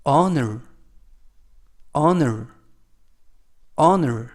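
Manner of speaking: "honor" begun on the vowel, H left unsounded; silent H. Shown as a demonstration. Teacher modelling the word for learners to copy, "honor" with silent H. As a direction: drop the H and say "honor" as "onor". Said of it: "H가 묵음이라서 발음이 되지 않습니다".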